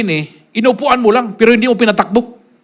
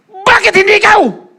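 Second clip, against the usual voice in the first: high, loud, slow comeback; preacher retorting to doubters; sharp retort; 12 semitones higher, 5 dB louder than usual. Retold or imitated. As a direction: fire it back at them. His retort to critics.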